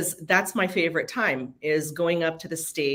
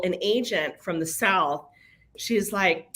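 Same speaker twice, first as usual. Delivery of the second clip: ordinary talk, nothing marked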